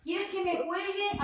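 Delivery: tinny call audio; phone call voice. Real, not acted. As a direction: voice over a video call. Trainer on Zoom call.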